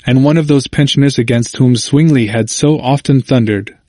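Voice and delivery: usual speaking voice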